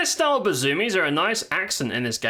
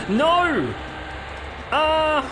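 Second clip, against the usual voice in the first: real yell; 11 semitones higher than usual. Real, not acted. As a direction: shout it out in dismay. Dying to the Banshee.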